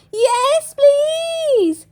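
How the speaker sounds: high excited cheer. A narrator's reading. Children cheering to bake spooky treats with Granny, high and excited. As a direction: shout it with glee, up high. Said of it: "cheered Peppa and George".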